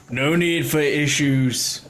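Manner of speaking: confident local, calm and sure; reassuring cart driver. Acted. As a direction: brush off the worry lightly. GM voices Tegan reassuring.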